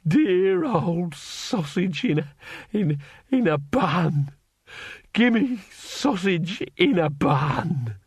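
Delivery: slow, high, tearful wail; high, slow sobbing plea. A narrator's reading. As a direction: wail it like a lost child. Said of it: "burst into tears".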